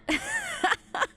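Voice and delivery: a real high laugh bursting out; high burst of laughter. Real, not acted. Player cracking up at Alistair's Templar past, high laugh.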